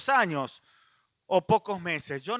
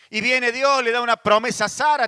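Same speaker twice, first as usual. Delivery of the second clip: louder emphatic preaching; fervent raised sermon voice; 4 semitones higher, 6 dB louder than usual. Real, not acted. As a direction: push the volume to drive it home. Preacher builds to shout.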